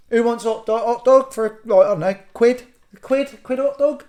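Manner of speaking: high-pitched hot dog seller touting; street hawker. Pretend. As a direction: call out to passers-by for custom. Acting out selling hot dogs outside.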